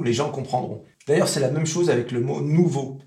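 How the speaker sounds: usual speaking voice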